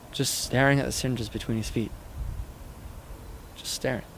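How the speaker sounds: softly, fading on the repeat; hushed recollection. A narrator's reading. Son recalls his father alone on the empty bench, hushed. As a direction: let it sink away softly, almost to nothing.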